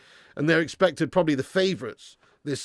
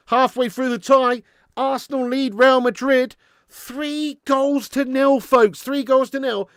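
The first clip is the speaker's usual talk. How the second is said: excited commentary; 8 semitones higher, 6 dB louder than usual. Real, not acted. Final whistle, live commentary.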